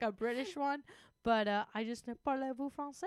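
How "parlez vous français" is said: lightly put-on French accent; a mock French Parlez-vous français. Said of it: "do it with a French accent now".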